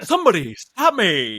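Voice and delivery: loud, high, hammed-up catchphrase; catchphrase impression. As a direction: belt it out like a cartoonish movie line. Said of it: "doing, like, a lot of that stuff".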